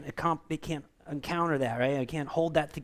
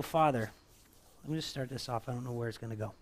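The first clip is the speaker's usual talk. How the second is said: prayer; 2 semitones lower than usual. Real, not acted. Pastor leads prayer.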